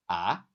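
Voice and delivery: "ah" swept up as a question; "ah" in second tone, rising. Acted. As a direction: ask "ah" as if you didn't hear. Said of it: "rising question".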